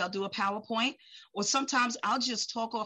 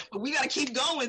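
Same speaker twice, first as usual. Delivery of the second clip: ordinary talk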